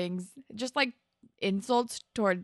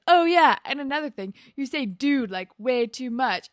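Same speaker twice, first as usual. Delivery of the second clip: loud, high opening burst, then easing; retold argumentative comeback; 4 semitones higher, 6 dB louder than usual. Jimmy adds another complaint about his friend, argumentative. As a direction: imitate him piling on complaints. Retold quarrel, boy piles on insults.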